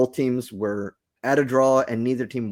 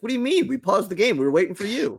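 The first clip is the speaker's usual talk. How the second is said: high, sing-song innocent protest, much higher; mock innocent; 9 semitones higher, faster than usual. Host retelling telling Liz they only paused the game, mock innocent. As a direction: play it like you did nothing wrong. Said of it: "And we're like".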